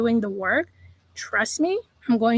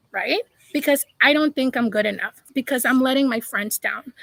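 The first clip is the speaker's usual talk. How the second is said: ordinary talk, nothing marked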